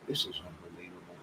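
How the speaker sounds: soft whispered disbelief; hushed aside to himself; whispered exasperation. Real, not acted. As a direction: breathe it out in exasperation. Exasperated aside while reading chat.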